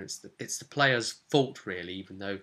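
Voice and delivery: ordinary talk, nothing marked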